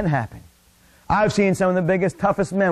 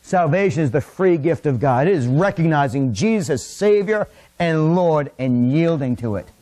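normal talking voice

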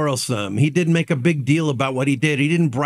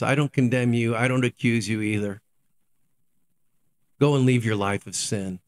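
gentle Jesus; 8 semitones lower, faster than usual. Acted. Jesus forgives the adulterous woman, low and gentle. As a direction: release her kindly, voice dropped low.

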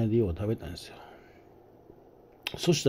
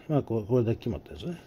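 ordinary talk, nothing marked

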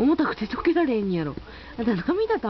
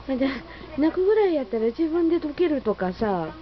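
higher, quick playful scolding of the pet; mock scolding the dog; 5 semitones higher than usual. Real, not acted. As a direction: tut at the dog in a raised, fond voice. 犬を叱りつつ話しかける. (犬を軽く叱る高めの声)

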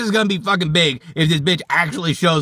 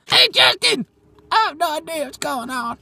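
Jeff Hardy voice; 7 semitones higher than usual. Retold or imitated. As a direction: sing out the name like Jeff Hardy. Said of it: "say my name in the Jeff Hardy voice".

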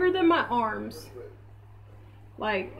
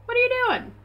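high mock-indignant dog voice; voicing the dog; 12 semitones higher than usual. Owner voicing her dog Lulu, higher, claiming the sticks. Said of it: "Lulu would be like".

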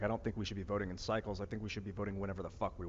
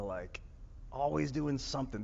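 normal talking voice

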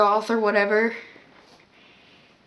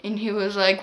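normal talking voice

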